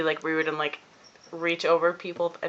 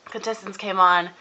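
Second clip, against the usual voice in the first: ordinary delivery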